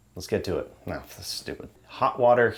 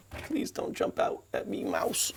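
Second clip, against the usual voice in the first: unvoiced, softer hushed plea; whispered plea; 4 semitones lower, 10 dB quieter than usual. Real, not acted. He pleads with a mouse in the shed: hushed whisper. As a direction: whisper it nervously to a hidden mouse.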